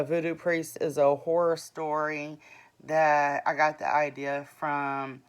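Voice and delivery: usual speaking voice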